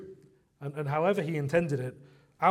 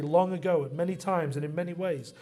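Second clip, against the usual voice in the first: louder, higher scripture proclamation; emphatic preaching; 4 semitones higher, 5 dB louder than usual. Real, not acted. Preacher proclaiming Hebrews to the congregation, emphatic preaching. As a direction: proclaim it with rising conviction, punching key words.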